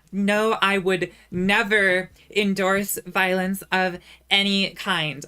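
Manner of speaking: slow, loud, deliberate public disclaimer; loud, measured official denial; emphatic disclaimer. Pretend. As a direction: spell it out word by word for the record.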